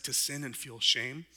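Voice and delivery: ordinary talk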